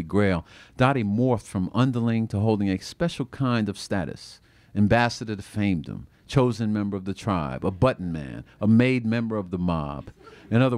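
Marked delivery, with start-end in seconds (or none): none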